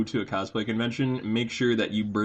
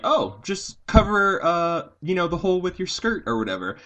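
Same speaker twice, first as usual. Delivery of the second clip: imitated casual advice-givers; 8 semitones higher, 6 dB louder than usual. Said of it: "everyone was just like".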